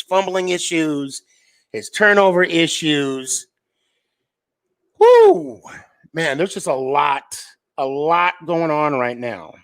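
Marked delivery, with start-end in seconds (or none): 5.0-5.6 loud high whoop; excited whoop. Real, not acted. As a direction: let out a big high "woo". Excited whoop mid-stream.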